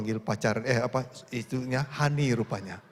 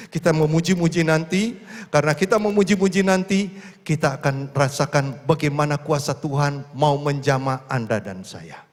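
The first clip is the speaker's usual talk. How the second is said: building exhortation; 4 dB louder than usual. Acted.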